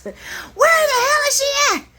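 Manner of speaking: Lucy storming in, shouting high; furious Lucy. Retold or imitated. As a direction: storm in demanding answers. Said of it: "here comes Lucy like a hurricane".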